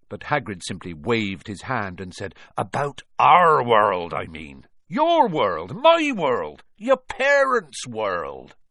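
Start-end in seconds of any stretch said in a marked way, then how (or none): 2.6-8.6 loud, raised, drawn-out insistence; exasperated insistence. A narrator's reading. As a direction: hammer each world home in exasperation. Exasperated giant, about to explode.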